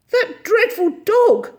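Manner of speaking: high, loud indignant exclamation; scandalised lady's outburst; outraged. A narrator's reading. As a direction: exclaim in horrified disapproval. Miss Lark scolds stray dog.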